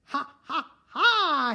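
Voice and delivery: loud, high gloating giant laugh; roaring laugh. Acted. Giant counting stolen gold, roaring laugh. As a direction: cackle over the gold coins. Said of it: "he roared".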